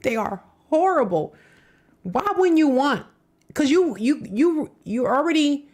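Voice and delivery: outraged. Real, not acted. Outraged reaction to clip.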